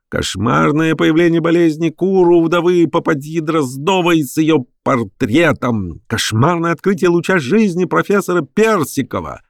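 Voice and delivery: high singsong headline cry; wailing newspaper crier. A narrator's reading. Newsboys crying headlines in the street, wailing. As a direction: howl the lurid news at high pitch. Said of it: "завывали неестественные сиплые голоса".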